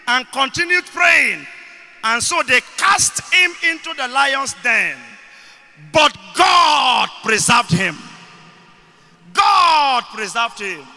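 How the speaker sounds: loud, emphatic sermon with key words drawn out; slow, weighty phrases with bursts of shouting; full-force preaching. Real, not acted. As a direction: preach it to the congregation, hammering each phrase. Preaches the Daniel story.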